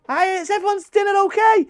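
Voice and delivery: high sing-song hostess voice; mimicking Christine. Retelling Christmas dinner, mimicking sister-in-law's high chirpy hostess voice.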